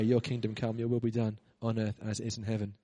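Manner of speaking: ordinary delivery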